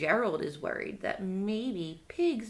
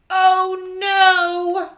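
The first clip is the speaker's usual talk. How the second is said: alarmed; 5 semitones higher, 11 dB louder than usual. Acted. Piggy alarmed at sneezing.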